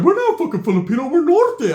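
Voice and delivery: high, loud outraged protest; indignant primo. Retold or imitated. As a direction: imitate a cousin yelling in protest. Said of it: "My brother's like".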